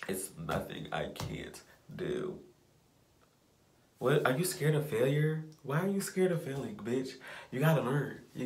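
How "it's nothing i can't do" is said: slow, soft whisper to yourself; whispered affirmation. Real, not acted. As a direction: say it slowly under your breath.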